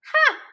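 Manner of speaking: one loud, very high bark of a laugh; hippo laugh. Acted. Hippo laughs at the cub's worry, loud high ha. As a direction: let out a big booming ha. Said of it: "said Henry and laughed".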